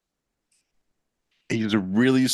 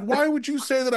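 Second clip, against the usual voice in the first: mock innocent, high. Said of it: "I was like".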